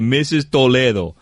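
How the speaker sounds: "Toledo" in Spanish, toh-LEH-doh; Spanish-style pronunciation. Shown as a demonstration. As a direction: say "Toledo" with pure Spanish vowels, stress on LEH. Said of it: "That's how you pronounce it".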